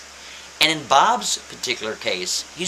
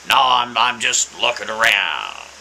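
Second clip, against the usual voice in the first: raspy voice; 3 semitones lower, 5 dB louder than usual. Acted. Old man answers again.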